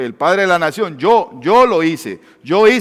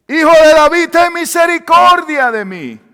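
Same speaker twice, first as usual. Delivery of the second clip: shouting with full lungs; 12 semitones higher, 9 dB louder than usual. Acted. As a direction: shout for mercy with all your lungs. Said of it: "con todo el pulmón". (grito desesperado a todo pulmón)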